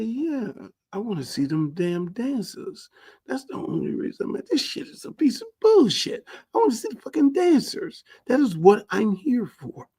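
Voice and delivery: high-pitched judge voice; hammed-up eager judge; mock judge. Judge insisting to Campbell on seeing the dancers, high mock voice.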